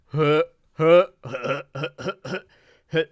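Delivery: put-on the dumb teen snicker; Butthead laugh. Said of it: "Butthead would clown".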